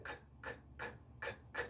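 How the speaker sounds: isolated K clicks of pure air; very soft, separated puffs of K; voiceless K puffs. Shown as a demonstration. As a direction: release bare K sounds without any voice. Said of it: "es viento".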